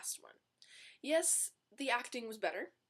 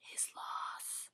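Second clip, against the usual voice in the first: soft dismissive whisper; whispered snub of the ex; whispered aside; 9 dB quieter than usual. Real, not acted. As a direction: toss it off in a whisper.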